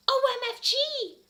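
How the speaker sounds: very high, loud and slow; gushing squeal. Acted. Ebony gushing after Draco's song, high squeal. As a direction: squeal each letter. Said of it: "I said when he was finished".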